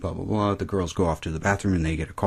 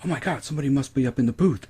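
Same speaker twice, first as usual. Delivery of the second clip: mock alarm; 8 semitones higher, faster than usual. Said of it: "someone's like".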